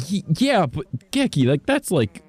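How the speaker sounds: high, halting protest; flustered disbelief. Real, not acted. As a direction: struggle to accept an overly generous gift. Protesting the donation is too much.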